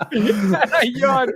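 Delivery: high, loud, through real laughter; laughing. Real, not acted. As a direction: barely get it out while cracking up. Repeats funny message while cracking up.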